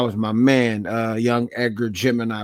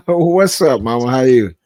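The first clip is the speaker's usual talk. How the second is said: excited greeting; 3 semitones higher, 8 dB louder than usual. Real, not acted.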